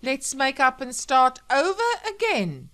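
loud, high, mocking sing-back; bitter mimicry. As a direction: parrot his plea with biting sarcasm. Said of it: "she said bitterly".

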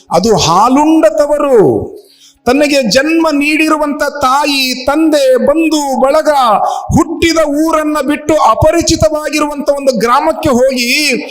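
high, drawn-out emotive oratory; impassioned preaching. Acted. Preacher on a bride leaving her mother, impassioned oratory.

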